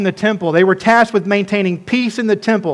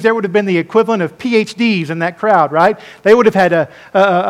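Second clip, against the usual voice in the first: normal talking voice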